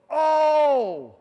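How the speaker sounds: loud, high, drawn-out cry of dawning understanding; hammed-up astonished exclamation; sudden realisation. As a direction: act out the penny finally dropping, big and loud. Imagined disciples suddenly understanding.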